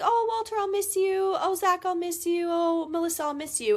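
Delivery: high, singsong mock-doting goodbye; mock gushing mom. Mom hugging each kid goodbye, mock gushing.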